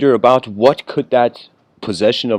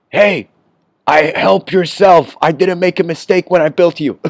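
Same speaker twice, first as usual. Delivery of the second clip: loud, high booming reply from the void; God's voice answering; 6 semitones higher, 5 dB louder than usual. Acted. Voice from the void to the person pleading, loud exasperated reply. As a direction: answer back loud and high, breaking into a laugh. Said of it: "the voice says".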